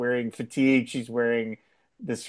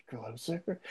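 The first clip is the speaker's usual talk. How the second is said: softer and breathy, voice dropped low; 3 semitones lower, 16 dB quieter than usual. Retold or imitated. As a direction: drop the voice and breathe it. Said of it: "her voice drops down a half register".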